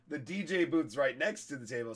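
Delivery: normal talking voice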